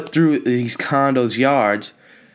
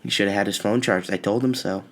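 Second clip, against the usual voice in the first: softer, quicker smug mother; smug mom; 3 semitones lower, 5 dB quieter, faster than usual. Retold or imitated. Son mocking his mom's I-told-you-so, quiet and smug. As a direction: toss it off with quiet smugness. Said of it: "and you're like".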